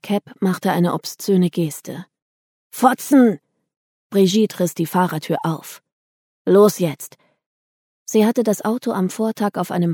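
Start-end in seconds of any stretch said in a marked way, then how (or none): 2.7-3.4 high, loud burst of abuse; angry insult. A narrator's reading. A youth abuses the women in the car, angry shout. 6.5-7.2 sharp command. A narrator's reading. Brigitte orders everyone out.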